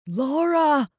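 high, loud, urgent call. A narrator's reading. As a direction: cry out her name in alarm. Man calls out on seeing her.

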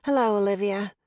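distracted. A narrator's reading. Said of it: "in a distracted voice".

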